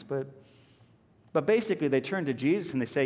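usual speaking voice

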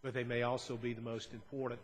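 usual speaking voice

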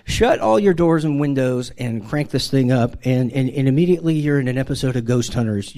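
ordinary talk

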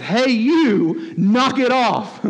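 high, drawn-out telling-off; acted rebuke. Jesus to the wind and waves, stern mock rebuke. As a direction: scold with mock authority, stretching the last words. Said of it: "he rebuked".

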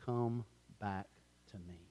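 soft, gentle call; father gently calls his son back. Retold or imitated. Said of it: "he gently said".

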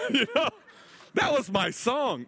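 milk the joke about his song; laughing joke. Real, not acted. Joking complaint with laugh.

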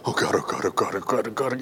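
breathless whispered "oh God" under the breath; frightened whispered prayer; panicked whisper. Retold or imitated. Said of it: "and you were like".

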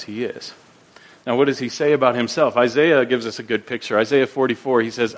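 ordinary talk, nothing marked

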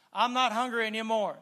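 strong preacher's affirmation; preaching declaration. Real, not acted. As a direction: declare it boldly to the church. Loud declaration.

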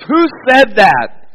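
big, bold, mean roar, pitched high; mean bellow. Retold or imitated. Khrushchev roaring at a heckler, big and mean. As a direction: bellow it as mean as you can muster. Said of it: "as big and as bold and as mean as he could muster".

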